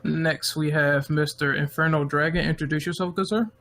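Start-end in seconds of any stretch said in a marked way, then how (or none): none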